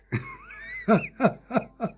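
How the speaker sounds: sudden high-pitched burst of laughter; squealing giggle. Real, not acted. Laughs at own remark.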